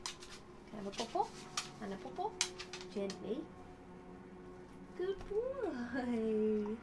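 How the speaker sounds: high, soft coaxing; coaxing pet voice. Real, not acted. Softly coaxing her dog for a paw. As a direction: gently coax the dog for a paw.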